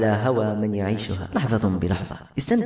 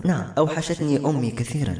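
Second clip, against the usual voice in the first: usual speaking voice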